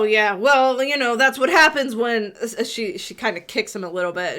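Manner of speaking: ordinary talk, nothing marked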